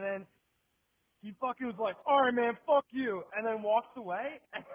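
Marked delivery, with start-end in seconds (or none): 2.1-3.2 high, loud mock outburst; retold angry send-off. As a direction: give up and curse him loudly. Beggar's retold parting insult.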